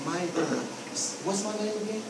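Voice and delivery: low, soft, halting and unsure; nervous. Shown as a demonstration. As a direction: stammer out the question shyly. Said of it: "and I'm nervous".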